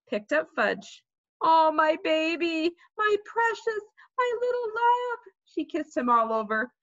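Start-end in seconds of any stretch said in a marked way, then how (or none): 1.4-5.2 doting mother. A narrator's reading. Mother picks up injured Fudge, cooing. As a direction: coo over the child, climbing in pitch. Mother comforts hurt child.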